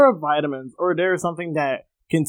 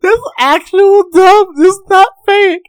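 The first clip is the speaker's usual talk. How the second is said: high, loud, laughing insistence; 13 semitones higher, 9 dB louder than usual. Real, not acted. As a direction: talk through the giggles.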